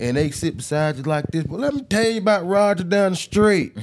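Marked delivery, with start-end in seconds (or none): 1.4-3.8 imitating an old country storyteller, pitched up; East Tennessee country twang. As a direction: lean in like a Tennessee neighbor with a tale. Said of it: "if they got a story for you".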